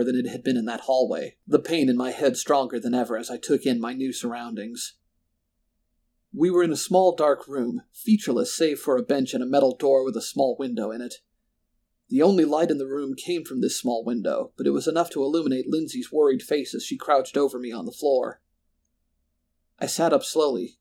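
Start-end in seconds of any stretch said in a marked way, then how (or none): none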